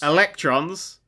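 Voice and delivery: electrons said slowly as one separate word; staccato. Shown as a demonstration. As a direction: lay out electrons on its own, slow and deliberate. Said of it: "one word at a time".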